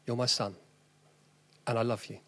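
soft, gentle reassurance; preacher voicing God to each father, tenderly; tender fatherly voice. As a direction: speak it softly, like God to a son. God's voice to fathers.

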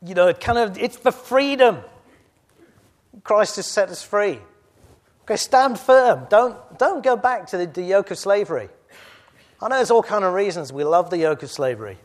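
loud, high preacher's battle cry; full-voiced rallying call to the church; rallying shout. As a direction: rouse the congregation to stand firm. Rallying cry after Wallace impression.

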